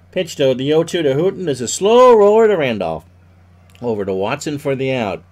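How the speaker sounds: baseball announcer calling the action; play-by-play. Acted. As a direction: call each pitch like live radio. Live play-by-play of baseball game.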